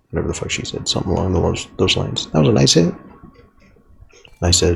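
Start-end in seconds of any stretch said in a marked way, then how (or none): 2.3-2.9 higher, louder chirpy compliment; bright mock praise from a classmate; girl's compliment. Girl at first base praising his hit, higher and louder. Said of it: "she was like".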